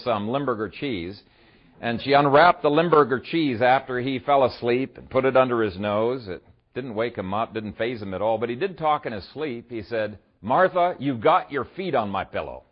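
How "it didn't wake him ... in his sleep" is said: ordinary talk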